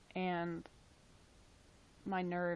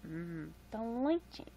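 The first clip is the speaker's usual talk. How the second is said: savoring; 2 semitones higher, slower than usual. Real, not acted. Savoring a tomato soup.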